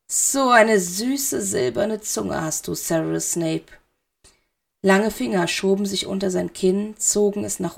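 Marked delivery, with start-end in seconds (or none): 0.1-3.7 slow, soft, sinister; slow soft villain; silky Dark Lord. A narrator's reading. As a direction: draw out the flattery.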